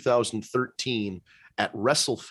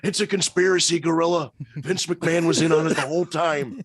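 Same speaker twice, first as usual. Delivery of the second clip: higher-pitched, hammed-up proclamation; mock conspiracy rant; 5 semitones higher than usual. Wrestler exposing who was in on it, mock rant. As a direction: rant it like a paranoid wrestling personality.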